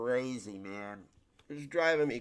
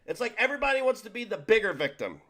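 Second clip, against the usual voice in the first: usual speaking voice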